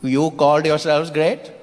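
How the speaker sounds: Indian accent, gently mocking questions; lilting Indian character, drawing out the punchline; sceptical Indian man. Indian man quizzing a British coloniser, sceptical Indian accent.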